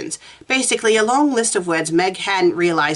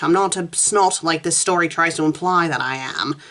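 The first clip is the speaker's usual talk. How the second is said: ordinary delivery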